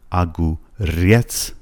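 slow اگوریتس, stress held on the final ریتس; stress on last syllable. Shown as a demonstration. Teacher showing where stress falls, slow اگوریتس stressed at the end. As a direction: break اگوریتس up, leaning on ریتس. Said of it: "فشارش روی رتس هستش".

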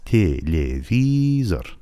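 "تلویزور" stretched as tye-lye-vii-zor; slow syllable-by-syllable pronunciation. Shown as a demonstration. Teacher sounding out the word for learners, slow syllables. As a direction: draw out each syllable of "تلویزور" evenly. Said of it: "تلفظش به صورت او هست".

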